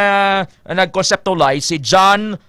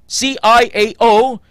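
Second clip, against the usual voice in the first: usual speaking voice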